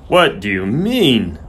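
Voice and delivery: slow, stunned question; aghast. A narrator's reading. Groom told the horse must be put down, aghast. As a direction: draw the question out in disbelief. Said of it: "Soames's mouth fell open".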